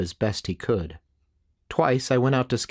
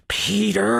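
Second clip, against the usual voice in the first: high, drawn-out cry of rage; enraged hiss; 9 semitones higher than usual. A narrator's reading. Samson raging over news of his killer's death, enraged hiss. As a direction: spit the name out in a long, seething snarl. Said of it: "In a rage, Samson hissed".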